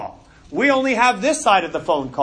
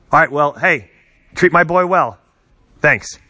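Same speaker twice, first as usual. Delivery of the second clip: low, casual one-sided phone chat; lower, offhand phone voice, with gaps for replies; pretend phone call; 8 semitones lower, 4 dB louder than usual. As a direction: chat to family on a make-believe call. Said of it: "let me just pretend that it rings".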